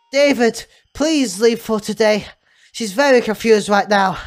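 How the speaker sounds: loud staff member taking charge; firm hospital carer. Acted. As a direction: send the visitor away in a big, firm voice. Plays hospital staff figure.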